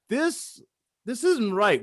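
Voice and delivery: high, incredulous "this isn't right"; shocked disbelief. Retold or imitated. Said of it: "I'm like".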